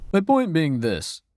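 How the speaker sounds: normal talking voice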